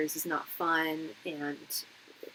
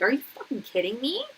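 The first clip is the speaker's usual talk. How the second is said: incredulous; 5 semitones higher, faster than usual. Retold or imitated. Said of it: "I was just like".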